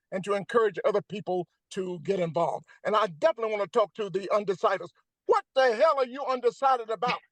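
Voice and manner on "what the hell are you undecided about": higher, incredulous challenge to undecided voters; exasperated scolding. Real, not acted. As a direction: scold them in disbelief.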